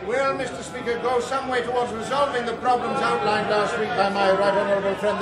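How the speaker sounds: ordinary talk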